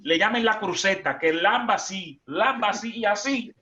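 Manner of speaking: high, loud and quick at the groom; comic wedding sermon shouted high; mock preaching. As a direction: boom it playfully at the groom.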